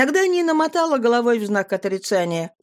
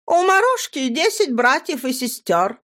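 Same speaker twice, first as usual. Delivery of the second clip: methodical listing; 6 semitones higher than usual. A narrator's reading. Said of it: "методично перечислять".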